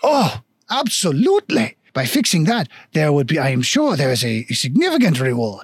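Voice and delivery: eager, swooping mock-French voice; hammy French stilt dwarf; bad French accent. Dwarf shopkeeper promises adventurers a reward, bad French accent. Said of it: "very shitty French accent".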